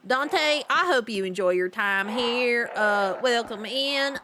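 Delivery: usual speaking voice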